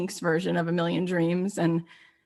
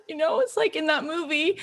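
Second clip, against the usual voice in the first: usual speaking voice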